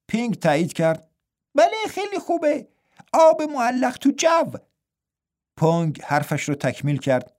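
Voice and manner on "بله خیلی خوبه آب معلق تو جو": high, light twin voice finishing his brother's line; a playful twin monk pitched well up. A narrator's reading. (صدای زیر و سبک راهب دوقلو)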